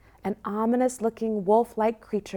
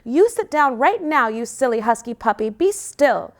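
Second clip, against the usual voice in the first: scolding; 3 semitones higher, 3 dB louder than usual. Acted. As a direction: tell him off like a cross sister. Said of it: "she says to him".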